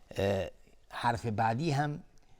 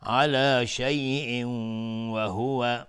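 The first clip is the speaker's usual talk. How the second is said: slow, melodic, drawn-out chant; Quran recitation; 7 dB louder, much slower than usual. Shown as a demonstration. Reciter chanting the whole verse slowly, melodic and devout. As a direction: let each phrase ring out, slow and devout.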